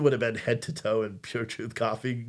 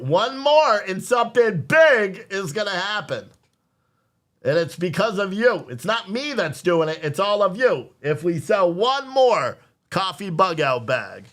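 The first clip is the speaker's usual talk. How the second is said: higher, louder, slow salesman build-up; rally the audience to buy one more; hype pitch; 6 semitones higher, 4 dB louder, slower than usual. Real, not acted. Streamer urging viewers to buy one more bundle, hyped.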